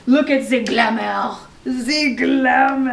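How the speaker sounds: loud, drawn-out theatrical swoon; slow, over-the-top gush; hammed-up glamour gushing. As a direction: stretch it out like a showy diva. Gushing over newly delivered dresses.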